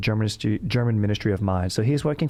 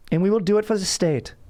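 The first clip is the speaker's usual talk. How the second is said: high, formal German-Aussie surfer bro; hammed-up German Australian surfer, pitched up; German Australian dude voice; 9 semitones higher than usual. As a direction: stiff German diction with surfer slang. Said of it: "It's my Australian German dude".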